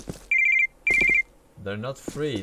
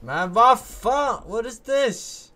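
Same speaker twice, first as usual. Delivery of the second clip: loud, high, drawn-out outrage at the glitch; a real frustrated outburst, slow at first; outraged yelling; 11 semitones higher, 6 dB louder than usual. Real, not acted. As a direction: yell at the broken game in disbelief.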